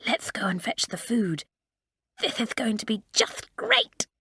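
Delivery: gleeful schoolgirl anticipation, rising in pitch; eager, high-pitched lift toward the end; unlowered voice. A narrator's reading. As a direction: let the excitement show, meant to be overheard. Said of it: "not troubling to lower her voice".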